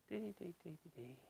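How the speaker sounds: very soft sing-song dee dee dee; quiet placeholder hum; mumbled filler. Real, not acted. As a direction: trail off under your breath. Placeholder for a name.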